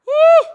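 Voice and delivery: a sudden high whooping cry. Real, not acted. Preacher to the congregation, high joyful whoop.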